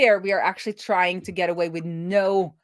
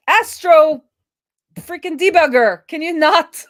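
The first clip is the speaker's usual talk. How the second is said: higher and louder annoyed scolding; exasperated; 10 semitones higher, 9 dB louder than usual. Real, not acted. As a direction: tell the debugger off, fed up. Debugger annoys her mid-stream.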